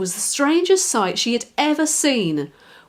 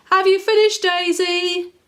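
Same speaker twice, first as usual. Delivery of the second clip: high, loud call across the garden; father calling his daughter; calling daddy; 10 semitones higher, 5 dB louder than usual. Acted. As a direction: call out to her from afar.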